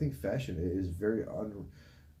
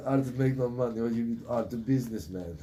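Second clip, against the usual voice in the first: put-on Russian accent. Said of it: "giving my Turkish parents a Russian accent".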